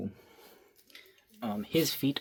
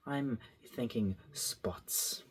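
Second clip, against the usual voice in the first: whisper; 6 dB quieter, much slower than usual. Real, not acted. Whispered idea while drawing.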